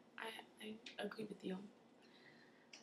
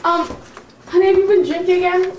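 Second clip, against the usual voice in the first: shrill nagging wife; 7 semitones higher, 10 dB louder than usual. Acted. Wife confronting drunk husband.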